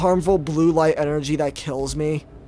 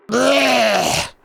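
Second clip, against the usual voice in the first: disgusted retch; 6 semitones higher than usual. Real, not acted. Bites a jellyfish.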